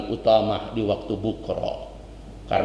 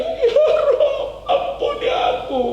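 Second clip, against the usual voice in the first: ratapan melengking tinggi dan keras; meniru tangisan; 4 dB louder than usual. Retold or imitated. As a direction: meratap sambil menangis histeris. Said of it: "Nangis dia". (anak menyesal meratap menangis dengan suara melengking)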